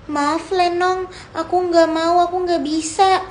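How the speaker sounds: ordinary talk, nothing marked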